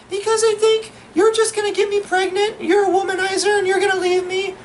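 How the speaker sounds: very high falsetto date impression; put-on falsetto explanation; girl voice. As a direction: answer as a squeaky mock-feminine girl. Acting out his date's answer.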